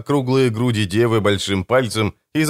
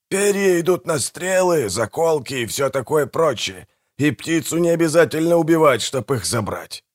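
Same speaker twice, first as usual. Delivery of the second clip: higher-pitched character voice, making a case; argumentative brother Manfred, explaining; 5 semitones higher than usual. Acted.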